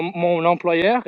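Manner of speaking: usual speaking voice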